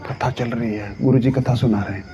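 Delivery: soft, low, calm brush-off; unruffled hush. Acted. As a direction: keep your voice down during the discourse. भागने की सलाह पर शांत. (धीमी, नीची, शांत आवाज़)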